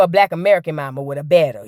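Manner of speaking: ordinary delivery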